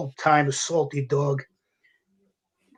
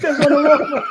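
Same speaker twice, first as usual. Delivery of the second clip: loud, high repeated no; emphatic denial; 9 semitones higher, 3 dB louder than usual. Retold or imitated. Rookie insisting to the guys he wasn't wrong, loudly. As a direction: protest it, louder and higher each time. Denies being on wrong line.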